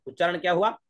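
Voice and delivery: normal talking voice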